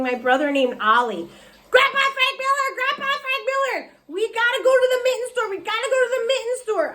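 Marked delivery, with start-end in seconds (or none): 1.7-6.9 high, fast excited yelling; child hollering. A narrator's reading. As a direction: holler for grandpa like an eager kid. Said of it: "I hollered real loud".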